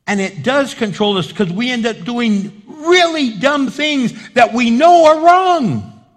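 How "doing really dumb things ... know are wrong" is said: high, loud, drawn-out preaching; emphatic preaching. Real, not acted. Preacher confronting our foolish choices, forceful. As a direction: drive it home from the pulpit at full force.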